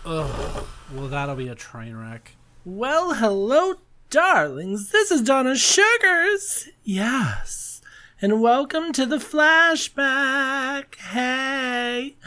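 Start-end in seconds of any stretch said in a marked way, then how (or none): 2.6-12.2 high, slow drag diva host voice; Donna Sugars voice. Acted. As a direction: gush it out like a campy diva host. Hosts show in persona voice.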